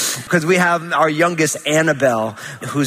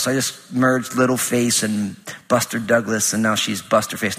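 normal talking voice

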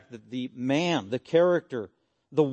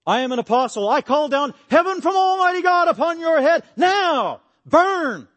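booming, much higher and louder curse from on high; furious shout; 13 semitones higher, 10 dB louder than usual. Acted. Paul calling fire down on the crowd that beat him, furious booming shout. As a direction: thunder down judgment like an angry prophet.

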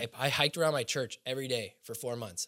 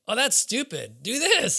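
mocking dismissive friend; 9 semitones higher, 6 dB louder, slower than usual. Worldly friends giving bad advice.

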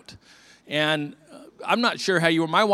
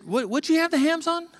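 high, sharp blurted question; 13 semitones higher than usual. Retold or imitated. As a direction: blurt it out in disbelief. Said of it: "And I blurted out".

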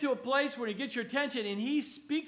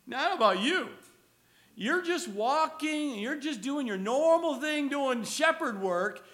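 normal talking voice

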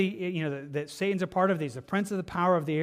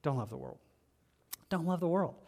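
soft plea; 6 dB quieter than usual. Real, not acted. Preacher repeating his warning softly to the congregation. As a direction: drop to a near-murmur.

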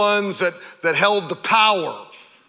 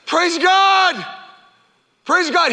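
loud, high joyful shout; shouting praise; 12 semitones higher, 4 dB louder, slower than usual. Retold or imitated. Healed leper running back to Jesus, joyful shout. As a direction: shout it to the whole world. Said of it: "He's shouting".

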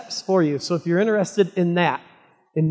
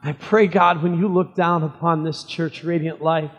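prayer. Acted. Pastor leads opening prayer.

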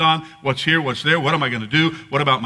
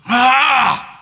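loud, high exasperated "aah"; high burst of pent-up exasperation; frustrated yell; 10 semitones higher, 7 dB louder than usual. Acted. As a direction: let out an acted cry of frustration. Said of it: "One of those".

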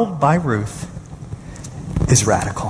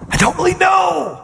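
incredulous quote. Retold or imitated. Preacher quoting the pastor's answer in disbelief. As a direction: echo his answer in disbelief.

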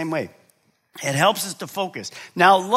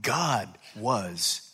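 awed whisper-soft wonder; 7 semitones lower, 5 dB quieter, much slower than usual. Acted. Congregant awed after service.

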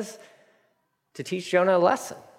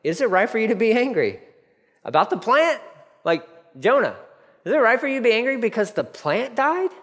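questioning God; 7 semitones higher than usual. Retold or imitated. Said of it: "then God said to Jonah".

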